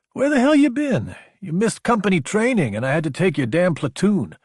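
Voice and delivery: high-pitched, exasperated grievance; indignant demand. A narrator's reading. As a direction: start very high and indignant, easing slightly as you complain. Friend confronts Logan over his absence.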